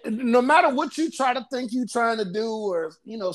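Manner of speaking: ordinary delivery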